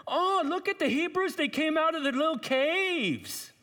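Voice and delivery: high, taunting sneer of a troll; mocking Philistines. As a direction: jeer up at them like a mocking bully.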